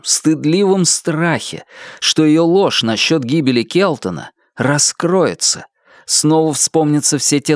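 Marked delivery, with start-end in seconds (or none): none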